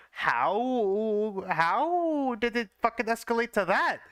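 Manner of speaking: high, slow incredulous outburst; can't believe it escalated like that; shocked disbelief. Real, not acted.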